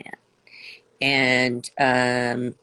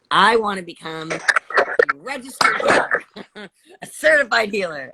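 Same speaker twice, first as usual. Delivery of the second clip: giggly; 5 semitones higher, 4 dB louder, faster than usual. Real, not acted. Giggly about her healer plans.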